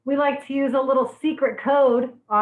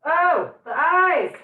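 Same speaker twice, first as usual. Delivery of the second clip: slow, higher, louder wail about the eyes; onion-stung cry; 6 semitones higher, 6 dB louder, much slower than usual. Real, not acted. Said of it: "I'm crying".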